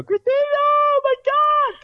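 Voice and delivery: high, slow, drawn-out fan shriek; fan scream. Pretend. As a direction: squeal her name like a starstruck fan. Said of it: "scream as loud as you can and go".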